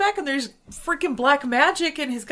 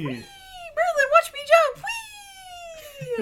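squeaky little squirrel. Acted. Kids playing as squirrels.

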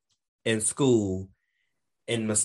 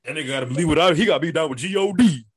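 imitating Dave; 4 dB louder, faster than usual. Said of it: "your answer was".

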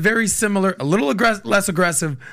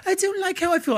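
much higher, softer mock British girl; mimicking British ex; 11 semitones higher, 3 dB quieter than usual. Retelling his British ex's complaint, high soft mock voice. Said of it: "She was a very British girl".

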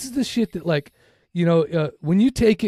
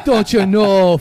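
put-on Minnesota accent; 5 dB louder, slower than usual. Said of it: "Is that a Minnesota accent?".

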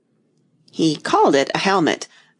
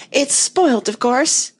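high, aggrieved wail; upset outrage; 7 semitones higher, slower than usual. A narrator's reading. Tweedledum laments his broken rattle, upset outrage. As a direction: lament it in a pitched-up voice. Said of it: "cried Tweedledum, beginning to stamp about wildly".

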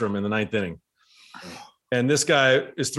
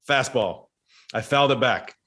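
normal talking voice

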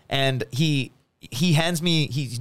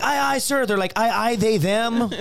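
mocking salute; 8 semitones higher than usual. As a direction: parody a recruit's salute. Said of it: "Instead of being like".

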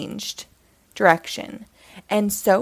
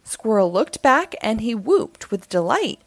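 ordinary delivery, nothing marked

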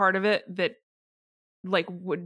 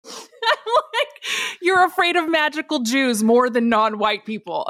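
laughing; 6 semitones higher, 6 dB louder, slower than usual. Real, not acted. Reading absurd quote, bursts out laughing.

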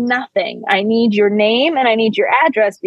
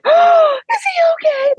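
panicked worry; slower than usual. Retold or imitated. Girl fears her friend is hurt, high crying shout. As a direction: shriek it like a friend near tears. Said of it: "And they're like".

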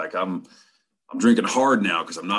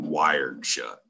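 ordinary delivery, nothing marked

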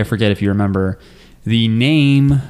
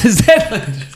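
New Zealand accent; 11 semitones higher than usual. Shown as a demonstration.

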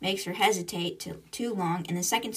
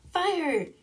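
called command; 9 semitones higher than usual. Retold or imitated. Crew launching their torpedo, retold command. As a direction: call the launch, pitched well up.

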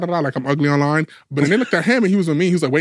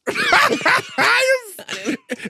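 high-pitched squealing laugh; shrieking laugh. Real, not acted. Podcast guest laughing after admitting jealousy, shrieking laugh. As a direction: burst into a long, high cackle.

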